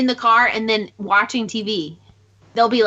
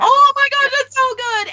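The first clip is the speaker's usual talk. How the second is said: high, loud burst of delight; excited; 13 semitones higher, 3 dB louder than usual. Real, not acted. Friend raving about a podcast, excited squeal. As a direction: gush about the show with a sudden squeal.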